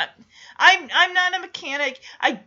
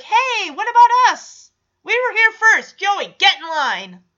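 higher, louder mock kid protest; indignant protest; 5 semitones higher, 6 dB louder than usual. Stephanie and Michelle telling Joey to wait, imitated indignant shout. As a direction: call out like kids cut in line.

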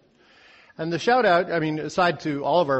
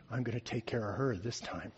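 under her breath; 6 semitones lower, 11 dB quieter than usual. Acted. Girl resolves under her breath.

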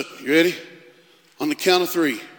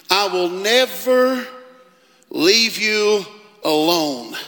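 preaching; 7 semitones higher, 4 dB louder than usual. Acted. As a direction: preach every word out to the hall. Preacher reads Hebrews with force.

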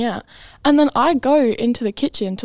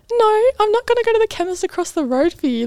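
polite refusal; 10 semitones higher than usual. Retold or imitated. Staffer refusing mum's errand request.

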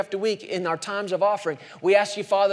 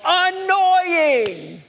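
loud, much higher, stretched-out cry; emphatic exclamation; 10 semitones higher, 5 dB louder than usual. Shown as a demonstration. Preacher telling what loveless speakers are to listeners, emphatic exclamation. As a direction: hammer the punchline home at full voice.

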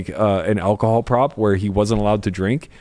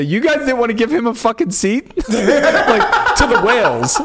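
high, laughing incredulous jab; mock the table for freezing him out, laughing; laughing mock outrage; 12 semitones higher, slower than usual. Poker pro mocking the whales, high laughing jab. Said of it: "and say".

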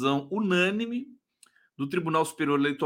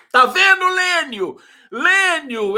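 much higher and louder triumphant call; gloating; 10 semitones higher, 9 dB louder, slower than usual. Real, not acted. Host gloating at Lênio for a wrong guess, loud and high. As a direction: rub it in with a loud high crow.

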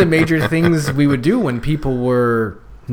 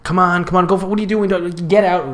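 higher-pitched mock pushing; badly impatient coach act, hurried along; impatient urging; 7 semitones higher, 3 dB louder than usual. Leader prodding reluctant kids to get going, mock impatient. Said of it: "So instead of, you know, saying".

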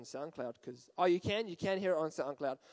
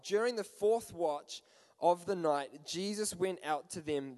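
ordinary delivery

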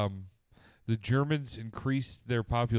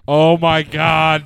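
loud, slow stunned exclamation; drawn out in shock; 5 semitones higher, 12 dB louder, much slower than usual. Real, not acted. Shocked at joke.

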